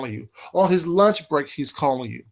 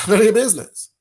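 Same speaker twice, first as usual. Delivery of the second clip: laughing it out, louder; laughing brush-off; 4 semitones higher, 7 dB louder, faster than usual. Retold or imitated. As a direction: repeat the brush-off through a laugh. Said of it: "And then I'm like".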